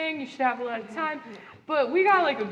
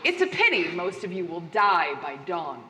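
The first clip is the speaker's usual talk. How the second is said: sinister host; 9 semitones lower than usual. Acted. Host's parting line.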